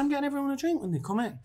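high, mock-innocent voice of himself at the bar; retold self. Telling his partner at the bar, a high mock-innocent reply. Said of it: "I said".

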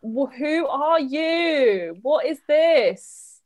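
slow, drawn-out incredulous question; baffled, dragging mock outrage; stunned disbelief. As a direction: spell out each word as if you can't believe her. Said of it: "And I'm just like".

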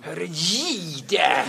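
high, very loud mock TV-parody voice; parodihärmning. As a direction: imitate the parody, shouting up high. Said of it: "Kan du härma vad de säger". (högljudd, hög parodiröst)

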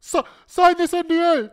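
giggling high mock order; laughing mock boss. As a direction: blurt it out laughing. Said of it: "Tony Khan's like".

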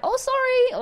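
mock polite, breezy sorry; showing how a polite stranger should have apologised, in a sweet voice; sweet apology. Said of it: "I would have been like".

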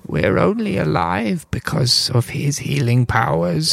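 a small gnome asking carefully. Acted. GM voices gnome.

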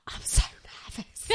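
hushed, halting mock-nervous quote; imitated nervousness. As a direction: drop to a near-whisper, imitating a nervous friend. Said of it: "looks at me and she goes".

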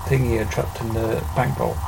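normal talking voice

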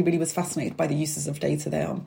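normal talking voice

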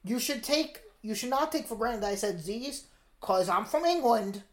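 comic British urchin voice; put-on British accent. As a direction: explain insistently in a mock British accent. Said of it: "listen to that British accent".

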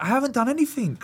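mock-indignant denial; protesting innocence. Defending himself to crying girlfriend.

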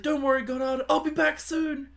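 put-on squeaky kid hero reassuring his dog; Jimmy Neutron voice. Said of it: "He's like".

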